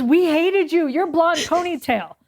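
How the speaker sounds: half-joking blame from a rival; mock accusation.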